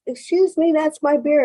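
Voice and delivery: high, polite stranger pointing out his beer; mock-polite claim, pitched well up; polite man at the bar. Man at the bar tells the speaker it's his beer, high and polite.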